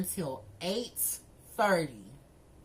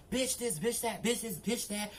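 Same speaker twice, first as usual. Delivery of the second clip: a hint of mocking the man, softer; mock insults; 3 semitones higher, 4 dB quieter than usual. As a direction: toss off his insults lightly, mocking.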